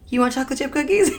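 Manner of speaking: high, fast, quoting her husband; a bright, quick offer of cookies; husband's question. Retold or imitated. Husband offering to bake cookies for his upset wife, chipper. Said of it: "and he said".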